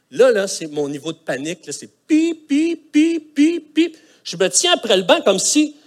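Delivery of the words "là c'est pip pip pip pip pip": high, loud pip pip pip like an alarm; panicky beeping. Retold or imitated. Pastor miming his panic on the plane, high beeps. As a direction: mimic a panic alarm going off.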